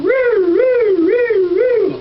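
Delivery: high, loud whooping siren noise; imitated jackpot siren. Winning the million on the slot machine, whooping siren noise. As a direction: wail it out like a slot machine alarm.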